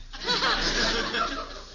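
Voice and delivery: a bright, high burst of laughter; amused laugh; hearty laugh. Real, not acted. Wife laughing at lunch box.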